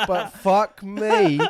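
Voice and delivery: high, slow laughing exclamation; laughing fuck me. Real, not acted. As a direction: laugh it out in disbelief. Laughing at the shock.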